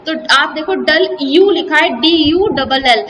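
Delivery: ordinary talk, nothing marked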